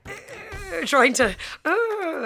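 high, loud mock straining grunts. Girl faking a poo to scare off the men, loud mock straining. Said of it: "I'm sitting there going".

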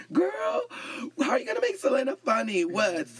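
high-pitched drag queen impression.

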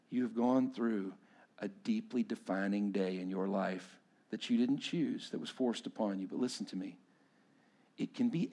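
soft, low, earnest preaching; hushed pastoral appeal. Acted. Pastor urging the wounded to go to Jesus, hushed and earnest. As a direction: bring it down to a quiet, personal plea.

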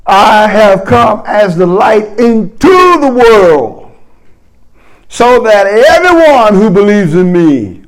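slow, loud, ringing scripture; fervent scripture reading. Acted. Preacher proclaiming scripture to the church, slow and ringing.

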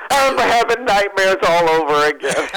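high, loud mock scream; Earl. Storyteller imitating Earl spotting the man who walked up, screaming. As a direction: wail it in comic horror. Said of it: "he just starts screaming".

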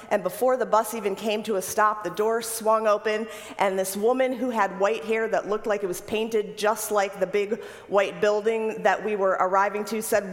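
normal talking voice

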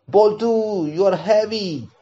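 mild moan under a heavy friend; complaining.

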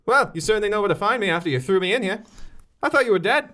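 high, indignant Irish lilt; put-on Irish accent. Prisoner facing the detective who jailed her, put-on Irish accent. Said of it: "She was Irish".

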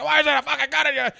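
high, loud accusing shout; mock yell. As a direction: demand it in a panic. Pacino confronting her.

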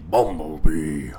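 deep, slow robot commander voice; Optimus Prime voice. Pretend. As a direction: drag out Bumblebee like a big robot leader. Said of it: "Optimus Prime impression".

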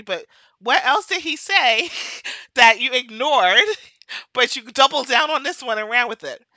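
amused, emphatic comeback; laughing retort. Retold or imitated. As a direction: argue back while cracking up. Laughing as she retells her retort.

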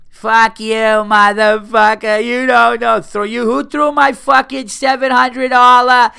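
put-on high, loud shrieking drunk woman; high, loud mock tirade; drunk girl yelling. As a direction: scream it like a drunk girl losing it outside a club.

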